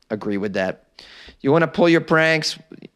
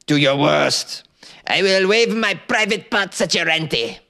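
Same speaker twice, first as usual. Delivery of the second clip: slow, taunting cartoon French accent; defiant mock-French, stretched out and rising high; Monty Python French person; 6 semitones higher, slower than usual. Defying the Paris police, hammed-up French accent. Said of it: "the Monty Python impersonation of a French person".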